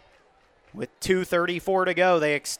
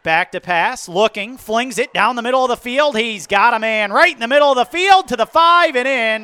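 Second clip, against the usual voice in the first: higher, louder building touchdown call; excited touchdown call; 6 semitones higher, 8 dB louder than usual. Real, not acted.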